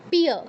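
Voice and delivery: পিয় as piyo, y fully voiced; sounded y glide. Shown as a demonstration. Teacher demonstrating conjuncts, পিয় with audible y. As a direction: join p to a clear iyo in পিয়. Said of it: "ইয় থাকলে ইয় উচ্চারণ করতে হবে".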